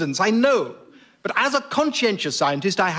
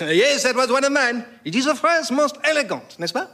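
high, self-satisfied French Voltaire; French accent; 5 semitones higher than usual. Acted. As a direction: preen in a higher French accent. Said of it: "in outrageous French accent".

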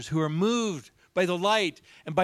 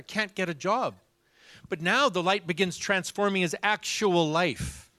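ordinary talk, nothing marked

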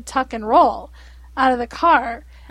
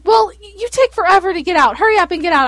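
fast, louder, higher nagging; impatient mom; 4 semitones higher, 6 dB louder, much faster than usual. Retold or imitated. Mother hurrying her daughter out of the truck, mock impatient. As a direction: hurry the kid out, half joking. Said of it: "I was like".

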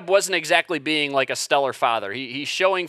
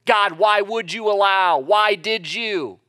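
raised, pleading cry of protest to God; retold scream; 5 semitones higher, 4 dB louder, slower than usual. Wronged person crying to the heavens, loud mock scream. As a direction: cry out louder and higher, stretching the last words.

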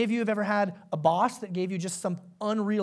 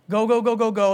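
louder, rushed chant of a frantic day; 7 dB louder than usual. Retold or imitated. Preacher mimics a busy mom's day, loud and hurried. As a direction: pile the words on like a mom with no break.

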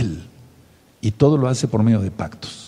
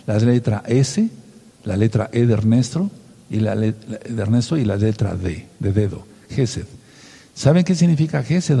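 normal talking voice

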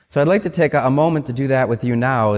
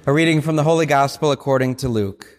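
liturgical Gospel reading. Acted. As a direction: proclaim it phrase by phrase, with pauses. Reads the Gospel at Mass.

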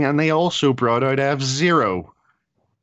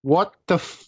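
exasperated; 6 semitones higher, much slower than usual. Real, not acted. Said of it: "that anger I mentioned".

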